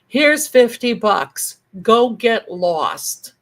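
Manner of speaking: loud, slow, drawn-out dismissal; gruff brush-off. Retold or imitated. Uncle paying aunt to leave, loud slow brush-off. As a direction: send her away loudly, dragging each word. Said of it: "hand my aunt a fifty dollar bill and say".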